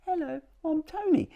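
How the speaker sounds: tiny high-pitched key voice, softly; Tony, little whisper. Acted. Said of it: "says it in a little whisper".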